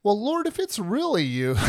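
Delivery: high-pitched imitation of a doubter; doubting. Peter unsure it is Jesus on the water, doubting. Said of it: "there's that doubt".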